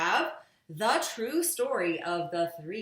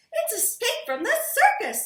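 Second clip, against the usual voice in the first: much higher Mrs Blair; high mother voice; 10 dB louder, faster than usual. A narrator's reading. Missus Blair guessing the circus, high-pitched. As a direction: lift it up high for the mum. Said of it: "said Missus Blair".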